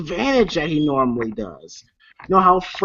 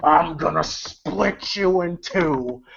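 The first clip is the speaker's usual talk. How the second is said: menacing villain; 3 dB quieter, slower than usual. Retold or imitated. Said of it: "Freddy goes".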